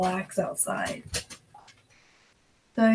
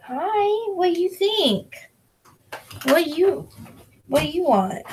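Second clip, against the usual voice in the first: high, sing-song voice for the dog; pet-talk voice; 9 semitones higher than usual. Real, not acted. Talking to the dog.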